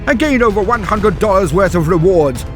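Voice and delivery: usual speaking voice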